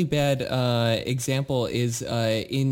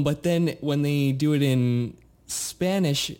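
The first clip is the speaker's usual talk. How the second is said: ordinary talk, nothing marked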